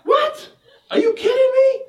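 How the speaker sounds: high, squealing indignant cry; outraged disbelief. Retold or imitated. Wife outraged at husband's lie, shrieking disbelief.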